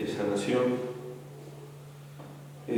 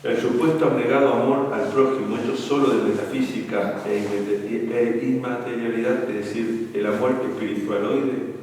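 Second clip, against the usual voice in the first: louder, weighty reading of a book passage; emphatic reading aloud; 5 dB louder than usual. Acted. Priest reading a book passage to young couples, loud and emphatic. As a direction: read it out like a lesson to the youth.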